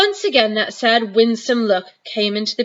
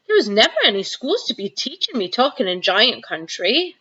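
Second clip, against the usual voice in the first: ordinary delivery, nothing marked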